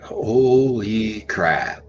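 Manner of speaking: very slow, drawn-out "Holy crap"; frozen, awed exclamation; stunned awe. Retold or imitated. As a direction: stretch each word in disbelief. Seeing three adult Bigfoot.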